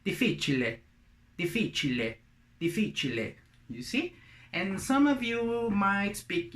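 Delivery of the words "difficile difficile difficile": "difficile" as dee-FEE-chee-leh; Italian pronunciation. Shown as a demonstration. Teacher gives difficult in Italian, Italian pronunciation. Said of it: "In Italian would be".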